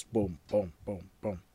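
low booms descending the stairs; evenly spaced, low thudding steps; imitated footsteps. Imitates the king's heavy steps.

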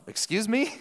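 high, incredulous question; mock-offended double take, pitched well up; taken aback. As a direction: play someone who can't believe what they heard. Acting disciple's reaction to blood remark.

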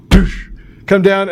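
ordinary talk, nothing marked

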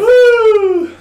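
high, loud triumphant whoop; loud woo. Real, not acted. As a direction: cheer as the story comes back. Celebrating full circle.

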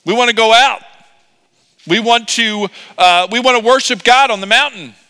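high, loud mocking retelling; hammed-up exasperated boss relaying a request; Pharaoh. Pharaoh reports two knuckleheads' request, exasperated. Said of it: "he's gonna say".

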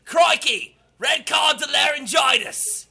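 loud, high-pitched mock Australian shout; bad Australian accent. Host standing in for the sick card-giver, loud bad Australian accent. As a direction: holler it in a badly faked Australian accent. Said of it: "I can't do an Australian accent".

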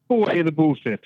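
ordinary talk

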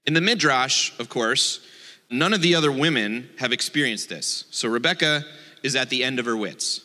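ordinary talk

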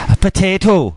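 Welsh accent. Shown as a demonstration. Answering a request for potato, exaggerated Welsh accent. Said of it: "say potato in a Welsh accent".

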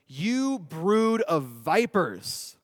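slow, weighty reading of John's rebuke; stern John. A narrator's reading. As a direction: draw each word out like a prophet condemning them. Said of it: "John said to them".

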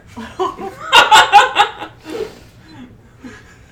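high burst of laughter; loud laugh. Real, not acted. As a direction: cackle out loud.

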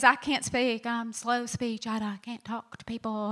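soft, hesitant, stammering plea; timid Moses. Retold or imitated. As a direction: shrink back like a man afraid to speak. Moses pleading he cannot speak.

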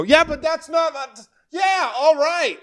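mock the sulky heckler, slow and high. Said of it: "you can just hear him go".

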